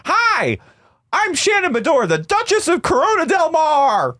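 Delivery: high, grand duchess voice; Shannon impression. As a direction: introduce yourself like a haughty duchess. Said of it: "Shannon goes".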